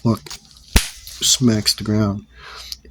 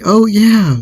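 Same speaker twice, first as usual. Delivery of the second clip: very high, loud mock retort, falling at the end; re-enacted emphatic confirmation; defiant echo; 12 semitones higher, 8 dB louder than usual. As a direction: throw the taunt back, higher and drawn downward. Acted answer to the challenge.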